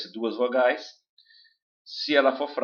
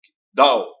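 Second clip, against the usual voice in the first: dá punched much louder; stress on dá; 10 dB louder than usual. Shown as a demonstration. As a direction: hit dá hard. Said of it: "o o quase desaparece". (dá com força)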